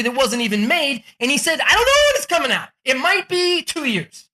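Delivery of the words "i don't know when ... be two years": high-pitched mock announcement; brazen excited pitch. Entrepreneur admits to buyers there's no release date, excited. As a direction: retell it pitched way up, unabashed.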